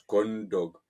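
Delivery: gun said with a short 'oo', dropping off; closed Yorkshire vowel. Shown as a demonstration. Explaining Yorkshire use to the comic, closed vowel in gun. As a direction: say gun dog with gun rhyming with good's vowel. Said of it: "the vowels are very closed".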